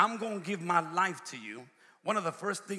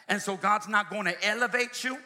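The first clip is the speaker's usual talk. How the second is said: building preaching; 4 semitones higher, 5 dB louder than usual. Real, not acted. Preacher warning the congregation about oversharing, building preaching. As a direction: preach it, rising to a loud, high climax.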